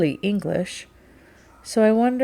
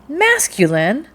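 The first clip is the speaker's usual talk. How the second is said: high, loud, drawn-out MASCULINE rising in disbelief; incredulous echo; 7 semitones higher, 6 dB louder, slower than usual. A narrator's reading. Rachel repeats the captain's word back to him, incredulous. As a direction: throw MASCULINE back as an astonished question.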